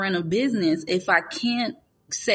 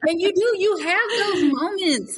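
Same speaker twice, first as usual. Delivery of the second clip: laughing agreement; 11 semitones higher than usual. Real, not acted.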